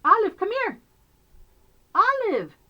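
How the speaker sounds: high, loud kitten call; loud high-pitched coaxing; calling a pet. Real, not acted. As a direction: call the cat over in a squeaky, carrying voice.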